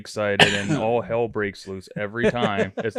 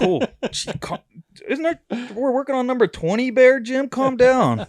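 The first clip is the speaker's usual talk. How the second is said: very high-pitched, worked-up pleading; retold exasperated plea; 13 semitones higher than usual. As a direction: re-enact begging an excited buddy to settle. Acting out telling excited Jim off.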